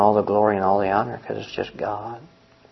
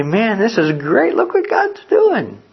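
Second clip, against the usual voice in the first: high, drawn-out exclamation of delight; excited marvelling; 11 semitones higher, 4 dB louder, slower than usual. Acted. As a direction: marvel out loud, stretching the words up high. Acted delighted onlooker riding along.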